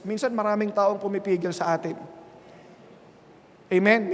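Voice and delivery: ordinary talk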